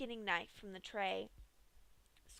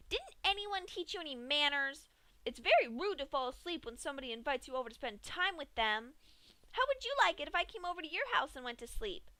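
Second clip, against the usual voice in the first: higher, fast, scolding Pinkie voice; frustrated lashing out; 6 semitones higher, 4 dB louder, faster than usual. A narrator's reading. Pinkie scolding Dash for passing out, high and fast. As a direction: lash out like an offended host. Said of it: "frustrated Pinkie lashed out".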